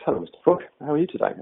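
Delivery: a hint of a sociable storybook turtle; turtle greeting. Turtle greets frog back in story.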